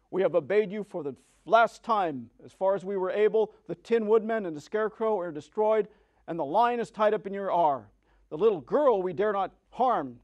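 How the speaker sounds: loud, proud monkey leader's farewell; defiant leader. A narrator's reading. Monkey leader telling the witch their service is over, loud and defiant. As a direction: declare freedom loudly to the witch.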